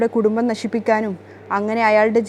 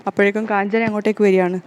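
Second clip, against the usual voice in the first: ordinary delivery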